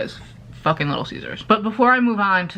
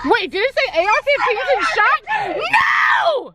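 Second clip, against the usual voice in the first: shocked shout. Real, not acted. Vlogger reacts to friend about the gun shop sign, shouting in shock. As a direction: shout it out in horrified surprise.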